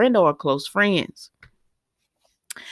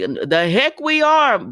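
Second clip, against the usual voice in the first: slow, loud, higher "The heck we are."; defiant refusal; 6 semitones higher, 5 dB louder, slower than usual. Retold or imitated. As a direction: refuse to give up, firm and drawn out. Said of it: "Portia was like".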